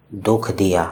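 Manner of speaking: usual speaking voice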